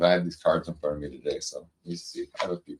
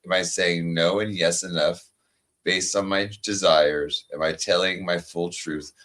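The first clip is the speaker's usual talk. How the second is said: card reading; 2 semitones lower than usual. Acted. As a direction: read it like a gentle affirmation, unhurried. Reads oracle card aloud.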